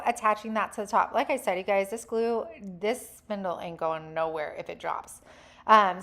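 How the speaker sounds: usual speaking voice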